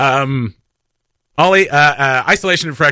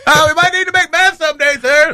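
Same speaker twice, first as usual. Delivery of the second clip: mock civil servant; 12 semitones higher than usual. Staffer answering a boss.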